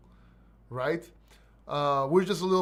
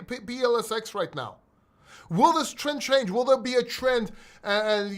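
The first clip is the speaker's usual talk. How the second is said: ordinary talk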